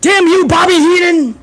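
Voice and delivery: high-pitched hammed-up announcer cry; exaggerated high exclamation; mock outrage. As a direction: scold the rival in a put-on announcer voice.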